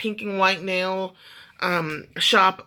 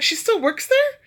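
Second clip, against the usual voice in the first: high, excited question; eager question; 4 dB louder than usual. Retold or imitated. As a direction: ask it thrilled and curious. Friend asks eagerly about Ivy.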